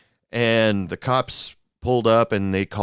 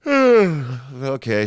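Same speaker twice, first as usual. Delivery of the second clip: mock yawn melting into the word; drawn-out put-on yawn; 4 semitones higher, 5 dB louder than usual. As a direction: yawn loudly through the word, as if tired. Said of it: "I started yawning as if I was tired".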